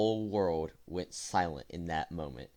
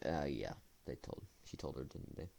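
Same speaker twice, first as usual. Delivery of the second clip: a much softer, lower dread-filled mumble; dismayed mutter; 5 semitones lower, 12 dB quieter than usual. Retold or imitated. Seeing teachers stare, he mutters his dread softly. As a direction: mumble it to yourself as it sinks in.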